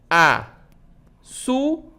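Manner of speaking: "açúcar" split into a, çú, car with long pauses; very slow, each syllable of "açúcar" standing alone. Shown as a demonstration. Teacher separating the word for pupils, syllable by syllable. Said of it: "Quando eu separo a palavra açúcar".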